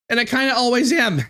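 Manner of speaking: real near-tears voice, pitched high; on verge of tears. Real, not acted. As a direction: talk high while holding back crying. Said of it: "I'm at the verge of fucking tears".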